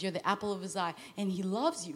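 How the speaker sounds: ordinary talk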